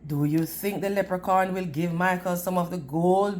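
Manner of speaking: ordinary delivery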